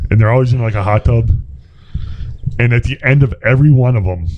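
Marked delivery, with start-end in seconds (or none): none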